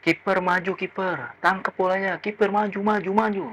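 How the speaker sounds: strained high-pitched yell held down in volume; imitated supporter yelling. Supporter behind urging the keeper forward, mock yell. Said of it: "ini supporter teriak".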